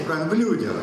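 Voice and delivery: ordinary talk, nothing marked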